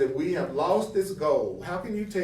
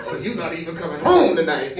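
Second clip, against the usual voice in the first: louder, teasing jab through laughter; laughing mock complaint; 9 dB louder than usual. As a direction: laugh while playing the upset partner. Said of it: "you might walk up to him and say".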